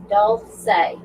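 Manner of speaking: Dulce said in two syllables, DOLE-say; corrected name pronunciation. Shown as a demonstration. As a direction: slowly show Dulce as DOLE-say. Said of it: "her name is pronounced".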